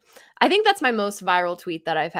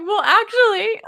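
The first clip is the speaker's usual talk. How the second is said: high, loud mock correction through giggles; hammed-up "well, actually" voice; mock pedantic reply; 6 dB louder, slower than usual. Host imagining a reply to the commenter, mock pedantic. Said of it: "my reply was like".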